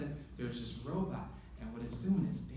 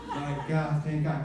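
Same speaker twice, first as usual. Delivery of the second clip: laughing relief, louder; relieved laugh; 5 dB louder than usual. Retold or imitated. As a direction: sigh it out laughing. Relief after fear.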